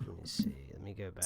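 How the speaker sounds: quick whisper to himself. Real, not acted. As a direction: whisper it under your breath, fast. Host mutters while finding graph.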